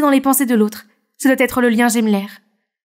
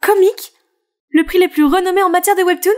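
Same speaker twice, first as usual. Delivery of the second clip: higher-pitched happy astonishment; amazed disbelief; 4 semitones higher than usual. Acted. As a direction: react as if you might be dreaming. Learns she won top prize.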